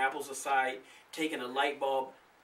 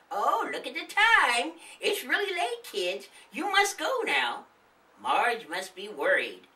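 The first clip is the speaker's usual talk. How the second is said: high, chirpy granny voice; a friendly old lady greeting guests; old woman voice; 11 semitones higher, 6 dB louder than usual. Acted. The old woman greeting the kids, high and chirpy.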